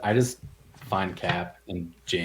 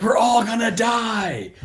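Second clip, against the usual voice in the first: high, slow, loud doom wail; hammed-up shout of doom; panicked shout; 12 semitones higher, 6 dB louder, slower than usual. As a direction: wail it like the world is ending. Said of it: "He's like".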